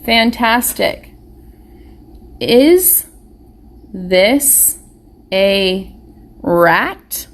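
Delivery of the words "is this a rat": put-on slow choral reading for young readers; word-by-word reading. Shown as a demonstration. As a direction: read "Is this a rat?" one word at a time, pausing after each. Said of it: "You're reading with me?".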